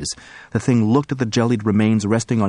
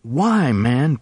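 on the verge of tears; 6 semitones higher than usual. Acted. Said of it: "on the verge of tears".

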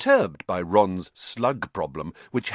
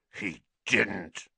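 growled disbelief, not raised; outraged growl; 3 dB quieter than usual. A narrator's reading. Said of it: "he growled at Hermione".

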